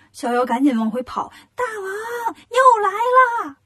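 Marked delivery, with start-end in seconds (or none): 1.6-3.6 very high, drawn-out alarm cry; hammed-up squealing shout, slow and high; panicked wailing call. A narrator's reading. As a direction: wail for your boss, stretching the words into a loud yell. Little demon runs back crying alarm.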